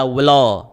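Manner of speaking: "wla" with a w before the l, like "welo"; extra w sound in "wla"; wrong w-glide. Shown as a demonstration. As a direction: show the mistake "wla", rounding lips too early. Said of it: "tidak dibaca wla".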